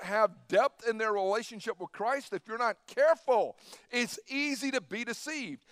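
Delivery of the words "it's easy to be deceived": normal talking voice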